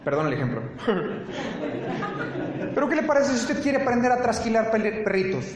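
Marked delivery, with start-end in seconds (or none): 0.7-2.7 a short high laugh at his own example; real laugh. Real, not acted. Preacher laughs after apologizing for his gun example. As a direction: laugh it off, a little embarrassed.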